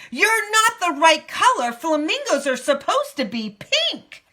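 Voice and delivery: high, loud scolding flamingo flock; yelling. A narrator's reading. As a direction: yell it accusingly, pitched well up. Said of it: "now they're yelling at her".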